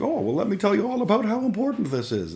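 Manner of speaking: high, fast, eager uncle voice; imitating the uncle. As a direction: pitch it up like a keen recruiter. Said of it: "And he's like".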